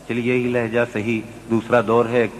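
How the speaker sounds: ordinary delivery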